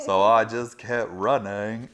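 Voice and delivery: loud, slow movie-character impression; Forrest Gump. Tattooist joking about the ever-growing tattoo, slow drawled impression. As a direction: stretch it out in a slow Southern drawl. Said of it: "Forrest Gump".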